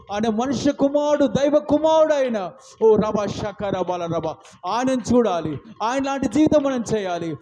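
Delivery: loud, driving sermon delivery; fiery preaching. Acted. Preacher urging the congregation, loud and fervent. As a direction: preach it with full force.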